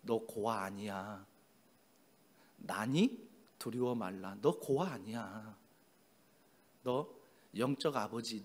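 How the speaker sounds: low, soft, unhurried comfort; fatherly reassurance. Acted. The Lord as spiritual father to his child, fatherly reassurance. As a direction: speak quietly, like a father settling a frightened child.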